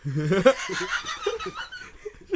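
squeaky burst of laughter; high squealing laugh. Real, not acted. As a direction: break into a shrill cackle.

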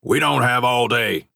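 impatient coach. A narrator's reading.

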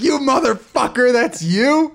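loud, high, hammed-up shout of betrayal; mock outraged yell. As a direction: yell it like you just caught him. Friend busted by fake account.

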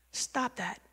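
very soft fatherly correction; fatherly Lord. Retold or imitated. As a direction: quietly check a fretting child. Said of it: "He speaks to me like my dad".

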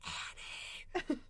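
faint unvoiced whisper of a name; whispered call. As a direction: breathe the name out softly. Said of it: "She goes".

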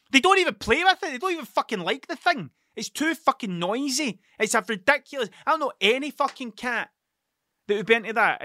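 high-pitched exasperated complaint; exasperated rant. Real, not acted. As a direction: rant about it, voice pitched right up. Ranting about a noisy cat toy.

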